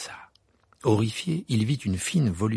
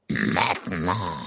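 very slow icy command; drawn-out cold order; ice spirit; 2 semitones lower, much slower than usual. Acted. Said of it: "dit la voix glacée".

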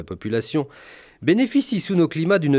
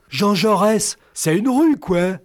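put-on much higher, dim teen voice; clueless teenager; 9 semitones higher, 3 dB louder, slower than usual. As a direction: play a dim high-school graduate. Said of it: "me disait récemment l'étron bachelier".

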